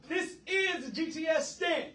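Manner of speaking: high, loud, slow title call; announcing shout. Acted. Host proclaiming his segment title, loud and slow.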